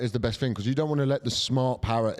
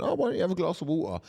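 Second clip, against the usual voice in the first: put-on higher, fast girlfriend voice; mock girlfriend; 8 semitones higher, faster than usual. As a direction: play her breezy advice. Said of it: "She went".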